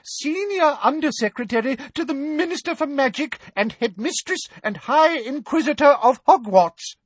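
cling to her titles in fear; high-pitched terrified. Acted. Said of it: "in a high-pitched, terrified voice".

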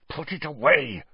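high, urgent snarl; Snape, snarling. A narrator's reading. Snape to Karkaroff over the forearm, snarled. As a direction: snarl it to cut him off. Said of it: "snarled Snape".

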